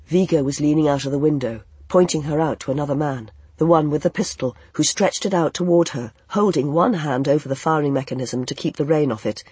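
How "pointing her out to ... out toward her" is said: ordinary delivery, nothing marked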